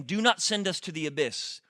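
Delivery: normal talking voice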